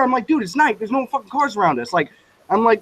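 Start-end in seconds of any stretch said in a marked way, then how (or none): 0.3-1.9 high-pitched, exasperated retort; retold protesting. Driver arguing with friend that no cars are around, retold protesting. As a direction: argue back in a re-enacted squabble.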